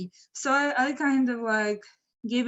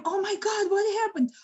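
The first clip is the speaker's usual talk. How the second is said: high, contained shriek of shock; retold scream; 9 semitones higher than usual. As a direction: cry out in mock alarm, pitched up. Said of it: "and I scream".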